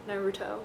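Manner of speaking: a reluctant American "Naruto". Shown as a demonstration. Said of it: "I hate saying it with American accent".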